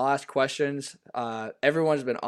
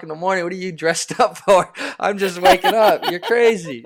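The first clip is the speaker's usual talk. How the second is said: laughing; 7 semitones higher, 10 dB louder than usual. Retold or imitated. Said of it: "I was like".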